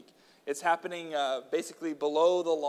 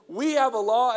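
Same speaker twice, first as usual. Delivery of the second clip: louder, higher insisting; insistent; 8 semitones higher, 7 dB louder than usual. A narrator's reading. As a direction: press the point hard.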